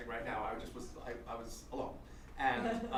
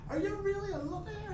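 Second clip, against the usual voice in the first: very high falsetto question; Con Ed woman; 3 dB louder than usual. Retold or imitated. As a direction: imitate an eager fan in falsetto. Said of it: "this woman who works for Con Ed".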